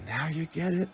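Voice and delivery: a soft, faraway question; hushed inner voice. Retold or imitated. The inner voice asking him quietly. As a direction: echo the voice he heard, barely voiced. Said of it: "then I heard, like".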